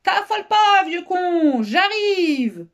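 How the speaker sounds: booming, high, drawn-out yell; huge carefree holler; stentorian shout. A narrator's reading. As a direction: bellow it from the top of the stairs. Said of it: "lança d'une voix de stentor".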